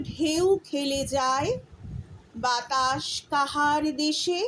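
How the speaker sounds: slow, measured poem reading; slow recitation of a Bengali patriotic poem. Acted. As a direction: recite each line slowly with feeling.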